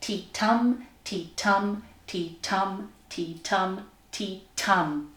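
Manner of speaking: "tea tum" in measured weak-strong pulses; heartbeat rhythm. Shown as a demonstration. Teacher demonstrating ten syllables, rhythmic chant. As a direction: count out five even "tea tum" beats, stress on TUM. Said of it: "ten bits to it".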